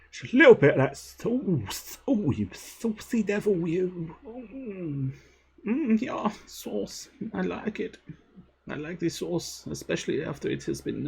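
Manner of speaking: high, hammed-up flirtatious voice; saucy camp voice. Streamer flirts over adding sauce, saucy camp voice.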